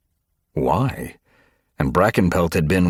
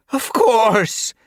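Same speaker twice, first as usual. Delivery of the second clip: much higher, pitched-up wail; distraught cat wailing to her leader; 6 dB louder than usual. A narrator's reading. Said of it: "she wailed".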